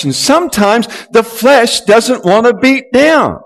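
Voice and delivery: high, loud rallying preacher call; preaching at full force. Acted. Preacher rallying the congregation, high and loud.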